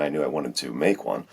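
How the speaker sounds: ordinary talk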